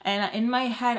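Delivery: ordinary talk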